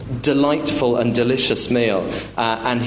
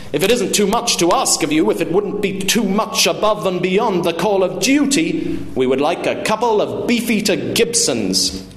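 raised-pitch, elaborate mock courtesy; sarcastic politeness; 7 semitones higher than usual. Father orders from the offended waiter, sarcastic politeness. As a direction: order drinks with pompous sarcasm.

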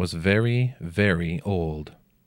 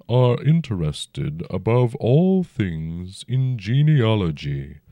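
slow, rumbling ancient dragon; 3 semitones higher, 3 dB louder, slower than usual. A narrator's reading. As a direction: speak as a huge old dragon, unhurried. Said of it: "the dragon said".

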